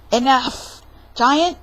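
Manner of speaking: loud, sharp call to order; loud stop. Acted. Narrator stopping the characters, loud. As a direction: cut them off firmly.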